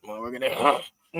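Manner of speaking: usual speaking voice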